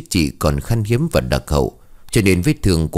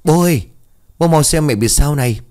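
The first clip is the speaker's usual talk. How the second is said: put-on higher, louder frantic child calling; calling dad in a panic; panicked cry; 9 semitones higher, 3 dB louder than usual. Boy calls father as mother collapses, panicked high cry. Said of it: "liền hoảng hốt".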